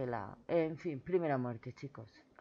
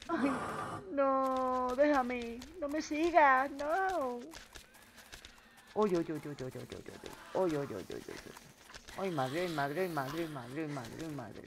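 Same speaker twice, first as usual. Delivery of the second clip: panicked; 3 semitones higher than usual. Real, not acted. Zombie attacking again.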